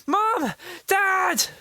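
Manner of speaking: high, loud call for parents; boy shouting for his parents. A narrator's reading. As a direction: yell for mom and dad in alarm. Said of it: "David shouted".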